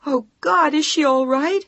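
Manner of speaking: high, loud, near-sobbing cry; tearful panic. Acted. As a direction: fight to hold the voice together. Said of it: "tears were pouring down her face".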